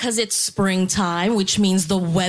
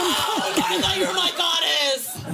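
mock dismay; 9 semitones higher than usual. Mock dismay about Trump.

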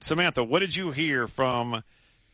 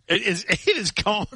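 high-pitched, breaking into laughter mid-sentence; laughing in disbelief; 9 semitones higher than usual. Real, not acted. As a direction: crack up while insisting it's all true. Host cracking up at the story.

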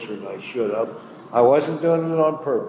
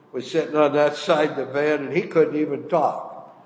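ordinary delivery

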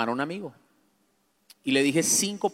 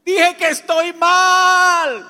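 high, very drawn-out yell; victorious shout; 11 dB louder, much slower than usual. Retold or imitated. Man celebrating his confession of being unwell, triumphant shout. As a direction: holler it like a winning goal. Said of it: "fue como una victoria".

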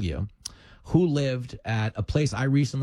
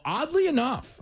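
laughing; 11 semitones higher than usual. Retold or imitated. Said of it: "'cause I was laughing".

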